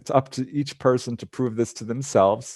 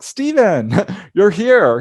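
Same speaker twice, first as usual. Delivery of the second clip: excited greeting; 9 semitones higher, 6 dB louder, slower than usual. Retold or imitated. Meeting brother on astral plane.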